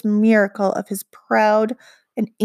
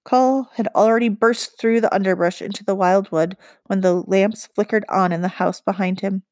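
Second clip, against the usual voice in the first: plain reading voice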